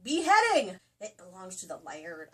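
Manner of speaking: usual speaking voice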